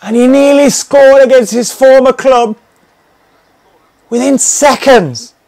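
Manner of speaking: high, loud alarmed excitement; excited commentary shout. Real, not acted. Gamer shouting in alarm at a near miss. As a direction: shout it as the chance flies by.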